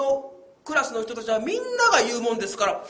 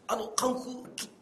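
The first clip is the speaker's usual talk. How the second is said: ordinary talk, nothing marked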